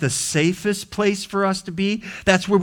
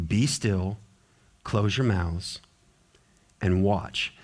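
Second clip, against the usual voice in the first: usual speaking voice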